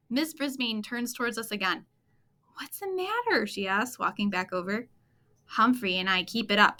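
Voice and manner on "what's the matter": puzzled teacher. Acted. Teacher asks the pets.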